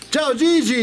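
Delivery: high, loud cheery hello; sudden squeaky hello to a friend; bright greeting. Real, not acted. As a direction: call out a big on-air welcome. Greeting a caller on air.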